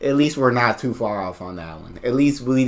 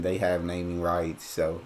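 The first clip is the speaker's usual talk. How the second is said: normal talking voice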